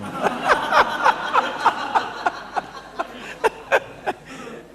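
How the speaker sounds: long breathy high laugh. Real, not acted. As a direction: laugh out loud. Big laugh after joke.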